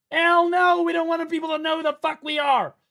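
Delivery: high and loud, holding the shout; loud rant. Retold or imitated. Streamer lecturing friend about flashy car, shouting. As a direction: bark it like a furious lecture. Ranting retell, peak loudness.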